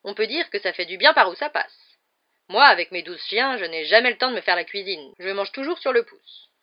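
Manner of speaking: loud, boorish aunt; tante Marge. A narrator's reading. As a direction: voice a self-satisfied guest holding forth loudly. Narrator voices boorish aunt Marge. (tante sans gêne, voix forte)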